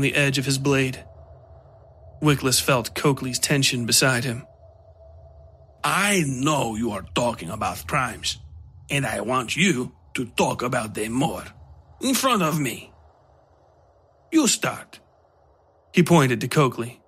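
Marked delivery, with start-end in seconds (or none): 5.8-15.0 lightly voiced menacing stranger, mocking; a hint of a sly knife-wielding foreigner; sinister Gato voice. A narrator's reading. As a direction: purr the insult with quiet menace. Gato's voice in story.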